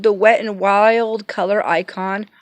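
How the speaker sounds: ordinary talk, nothing marked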